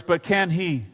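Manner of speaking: ordinary talk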